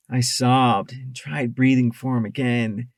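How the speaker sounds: plain reading voice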